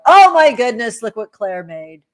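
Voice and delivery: loud, delighted shout of joy; thrilled cry showing off a gift; excited yell. Retold or imitated. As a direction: yell it out across the house. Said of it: "that person that yells out".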